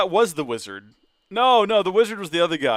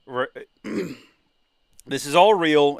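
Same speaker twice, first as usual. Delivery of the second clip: normal talking voice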